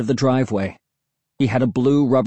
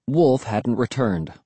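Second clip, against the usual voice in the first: plain reading voice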